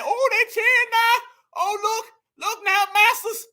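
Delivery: high-pitched put-on gushing voice; mocking spectator voice. Commentator voicing a man in the cheering crowd, high mocking voice. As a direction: pitch way up, parody an awed onlooker.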